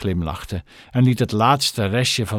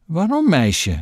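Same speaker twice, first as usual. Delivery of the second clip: higher-pitched, puzzled question; surprised; 7 semitones higher than usual. A narrator's reading. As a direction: ask it in mild astonishment. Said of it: "vroeg meneer Stink verbaasd". (verbaasd, met hogere stem)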